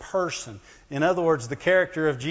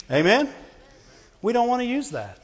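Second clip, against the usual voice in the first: ordinary talk, nothing marked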